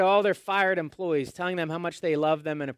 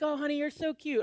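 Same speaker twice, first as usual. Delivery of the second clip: doting mom; 10 semitones higher, 4 dB quieter, faster than usual. Retold or imitated. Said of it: "your mom's like".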